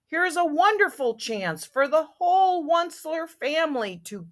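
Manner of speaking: animated storybook reading; story narration. A narrator's reading. Once-ler phoning his family to come, animated storybook narration. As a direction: read it out with eager emphasis.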